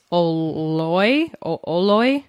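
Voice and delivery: "Oloi" sounded out slowly and tentatively; unsure pronunciation. Real, not acted. Reader unsure how to pronounce the alien name, tentative "Oloi". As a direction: feel your way through "Oloi", stressing "loy". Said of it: "I really don't know how to pronounce".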